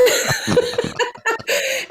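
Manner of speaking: high, real burst of laughter; delighted laugh. Real, not acted. Mother amused by her own story, delighted laugh.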